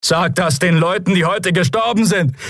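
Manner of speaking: very high, bitter shout; angry accusation. A narrator's reading. Citizen blames officers for the dead, angry shout. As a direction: throw the dead in the captain's face. Man hurls accusation back.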